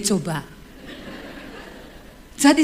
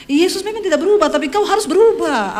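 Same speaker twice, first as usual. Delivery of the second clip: louder, higher, faster, driving the point home; emphatic preaching; 6 semitones higher, 5 dB louder than usual. Real, not acted. Preacher pressing the church to change, forceful. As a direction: preach it hard at the congregation.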